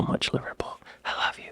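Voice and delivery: soft, breathy whisper of adoration. Real, not acted. Whispering his love to Liverpool for boycotting the Sun. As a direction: whisper it like a secret love note.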